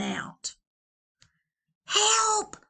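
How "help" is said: very high loud squeal; cry for help. Acted. Valentine to the girls, shrieking. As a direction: squeal for rescue. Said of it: "cried the Valentine".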